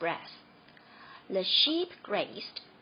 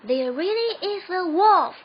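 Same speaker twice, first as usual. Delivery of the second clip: high, very loud plea; desperate shout; 8 semitones higher, 10 dB louder than usual. A narrator's reading. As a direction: beg them to believe. Boy desperate.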